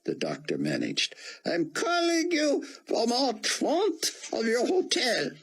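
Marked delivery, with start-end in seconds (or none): 1.5-5.3 put-on much higher, slow, heavy Hebrew accent; a frail old rabbi straining through a thick Hebrew accent. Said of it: "Buck fought to understand him".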